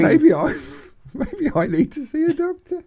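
very high, squeaky laughing speech; helpless laughter. Real, not acted. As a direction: barely get the words out for laughing.

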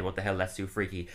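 deadpan repeat, low and soft; quiet low reading. Retold or imitated. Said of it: "says exactly what one of them just said".

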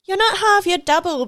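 upbeat slogan voice. Retold or imitated. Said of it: "constantly say, like".

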